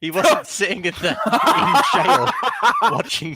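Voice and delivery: giggling through words; words broken by laughter; laughing. Real, not acted. Laughing while explaining.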